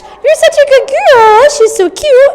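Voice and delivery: very high, loud, slow cooing praise; high pitch praise. Shown as a demonstration. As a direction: coo at the puppy way up high. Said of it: "You can do high pitch".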